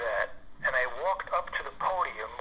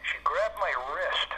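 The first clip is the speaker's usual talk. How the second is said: normal talking voice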